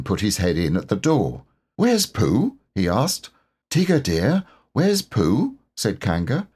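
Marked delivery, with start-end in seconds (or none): 1.8-2.6 put-on higher, asking boy; a boy poking his head in, asking; lightly voiced Christopher Robin. A narrator's reading. 3.7-5.6 higher, motherly asking; mother Kanga asking Tigger gently; lightly voiced Kanga. A narrator's reading.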